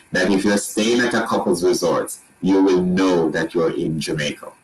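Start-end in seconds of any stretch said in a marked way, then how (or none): none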